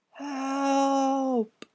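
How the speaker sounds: high, soft, faint plea; whispered cry. Acted. Trapped ghost boy calling faintly from the bell, soft high plea. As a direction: call out weakly from far away. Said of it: "whispered a voice".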